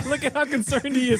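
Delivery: giggling through the words; laughing. Real, not acted. Laughing at video.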